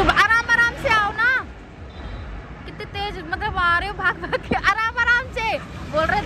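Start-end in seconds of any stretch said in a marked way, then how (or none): 0.0-1.3 high calling voice across the crowd. Real, not acted. Mother calling to her running child, high and carrying. 4.7-5.6 high calling voice across the crowd. Real, not acted. Mother calling to her running child, high and carrying.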